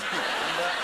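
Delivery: usual speaking voice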